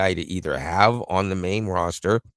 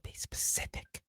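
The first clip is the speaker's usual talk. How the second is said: whisper; 19 dB quieter than usual. Real, not acted. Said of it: "speaking to Gemini".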